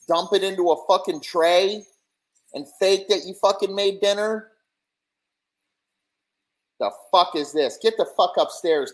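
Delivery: high, loud outraged yelling; furious rant. Retold or imitated. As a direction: scream it like the leftovers are an insult. Husband rants about leftovers.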